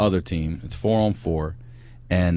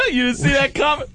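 high, laughing mockery; mock gloating; laughing taunt; 4 dB louder than usual. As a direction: tease him through laughter. Taunting drunk uncle, laughing.

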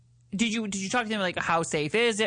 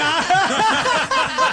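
loud laugh; 11 semitones higher, 8 dB louder than usual. Real, not acted. Cohost cracking up at the giving head joke, loud laugh. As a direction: burst of high, loud laughter.

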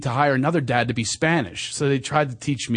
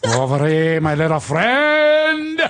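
Scarface impression; much slower than usual. Shown as a demonstration. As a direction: stretch it out like Scarface. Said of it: "I even rolled the R".

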